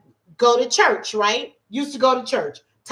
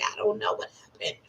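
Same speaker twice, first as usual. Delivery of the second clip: whispered, barely there; retold whispered dismay; dejected whisper; 12 dB quieter, faster than usual. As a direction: say it like you can't believe it. Dejected after failing test.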